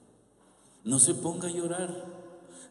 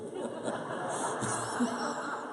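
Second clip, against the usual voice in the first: laugh; 11 semitones higher, 7 dB quieter than usual. Real, not acted. Audience laughter moment.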